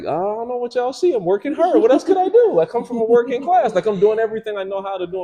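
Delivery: rising frustration, voice going up and up; exasperated outburst. Retold or imitated. Protesting to his friends, exasperated and climbing high. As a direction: pitch climbing high and loud, pleading. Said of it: "And I'm just like".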